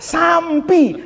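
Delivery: high "sampi" with an m before the p; Betawi added M. Shown as a demonstration. Pak answering the preacher, "sampi" with an added m. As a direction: answer "sampi", closing the lips on an extra m. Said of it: "masih dikasih M".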